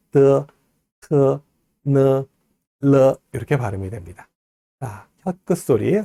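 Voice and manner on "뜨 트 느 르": 뜨, 트, 느, 르 with tongue leaving the back of the front teeth; each of 뜨, 트, 느, 르 held long and level; tongue-tip sounds. Shown as a demonstration. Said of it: "앞니의 뒷부분에 붙었다 떨어지면서".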